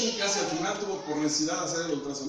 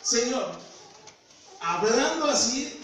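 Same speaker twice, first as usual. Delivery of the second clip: slow, emphatic appeal, a little raised; imitating a frank talk with the Lord; man-to-man address; 4 semitones higher, 3 dB louder, much slower than usual. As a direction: square up to God, stretching out each phrase. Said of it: "así le hablaba al Señor".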